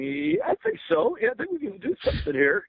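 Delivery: put-on a kid trying to sound cool while shaking; nervous reply. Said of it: "shaking in my shoes here".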